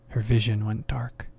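ordinary delivery, nothing marked

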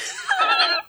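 high giggle; helpless little high laugh; amused laugh. Real, not acted. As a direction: burst into high-pitched giggles. Laughing at own anecdote.

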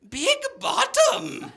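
put-on very high, slow old lady verdict; grandma's judgment. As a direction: drag it out like a catty grandma. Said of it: "turn to me and go".

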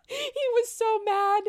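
high, loud, laughing through the words; helplessly amused exclamation; real laughter. Real, not acted. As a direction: crack up at the memory. Laughing at memory of husband's anger.

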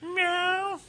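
high, mock cat meows; cat meowing. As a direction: meow like the cat at its bowl.

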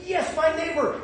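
high-pitched mock delight; excited. Churchgoer thrilled to spot a neighbor, mock excitement. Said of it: "Oh, you get so excited".

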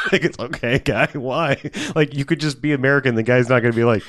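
ordinary talk

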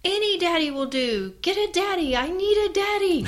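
put-on high, loud shrieking child's demand; imitating toddler scream. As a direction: shriek it like a furious little boy. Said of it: "starts screaming at me and says".

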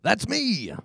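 high, gruff pirate growl. Acted. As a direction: play a swaggering sea captain. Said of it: "That was my pirate voice".

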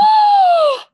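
loud shocked high gasp; sharp gasp. Real, not acted. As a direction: gasp in sudden horror. Friend hit by ricochet.

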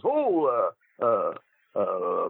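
halting, low phone hesitation; Emile Dupre. Retold or imitated. Calling Rip Rogers at his hotel, mock hesitant. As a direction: imitate a caller drawing out his uhs. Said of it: "So Emile calls me, he goes".

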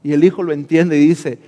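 normal talking voice

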